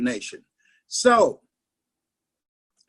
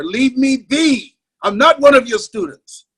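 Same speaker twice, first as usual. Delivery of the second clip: put-on defiant boy; 5 semitones higher, 8 dB louder than usual. Boy defies teacher in class.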